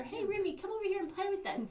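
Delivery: put-on high and quick, eager kid voice; friendly invite. Principal acts a classmate welcoming the new kid, high and eager. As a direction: call a new kid over to play.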